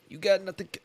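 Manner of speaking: abandoned stab at Italian mobster; attempted Italian mobster. Shown as a demonstration. Fella from Kentucky can't do it, faltering Italian mobster attempt. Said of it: "it's my own voice".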